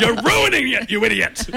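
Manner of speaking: high-pitched mock heckling; hammed-up outraged fan; screaming out. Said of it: "I'm screaming out".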